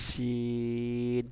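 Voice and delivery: long held শিন with air flowing; শিন stretched slowly, air from inside; drawn-out shin. Shown as a demonstration. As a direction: let the breath carry শিন out long. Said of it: "সেইভাবে উচ্চারণ করবেন".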